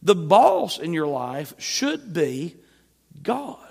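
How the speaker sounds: normal talking voice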